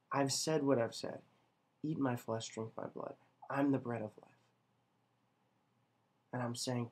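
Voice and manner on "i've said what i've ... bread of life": soft, sinking to a whisper on drink my blood; quietly insist, almost whispering; hushed firmness. Retold or imitated. Jesus quietly holding firm to his disciples, hushed.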